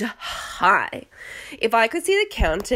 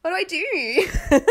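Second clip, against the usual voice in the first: mock panic; 9 semitones higher, slower than usual.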